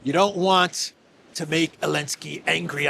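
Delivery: normal talking voice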